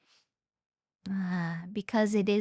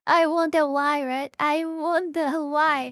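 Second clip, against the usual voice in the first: louder, higher mock wondering; sarcastic; 5 semitones higher, 5 dB louder than usual. Real, not acted. Reacting to her saying what she learned, sarcastic. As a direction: feign puzzlement, drawing out the final repeat. Sarcastic jab over the played video.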